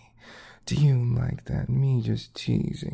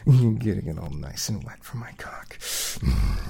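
whispering; 6 semitones lower than usual. Acted. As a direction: hush it, intimate and teasing.

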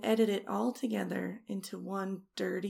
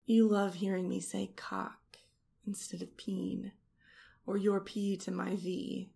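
normal talking voice

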